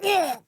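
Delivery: high retching ugh; gagging. Retold or imitated. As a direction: gag like the medicine hit. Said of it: "an instant gag".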